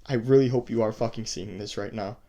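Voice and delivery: usual speaking voice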